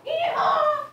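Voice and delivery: high loud cowboy whoop; yelled. Pretend. Sudden outburst.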